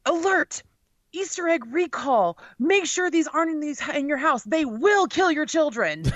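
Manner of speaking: high, dramatic alarm-bell read; clickbait headline voice. Retold or imitated. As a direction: read it like a scary news alert. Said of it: "the article's headline was like".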